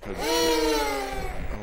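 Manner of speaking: long slow terrified shout; real fright at a jump scare, dragged-out yell; drawn-out scream. Real, not acted. Player startled by the game, drawn-out scream.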